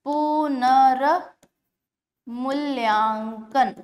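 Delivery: पुनर्मूल्यांकन stretched out syllable by syllable; slow motion. Shown as a demonstration. Teacher asks students to try it in slow motion. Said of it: "slow motion में बोल के देखिए".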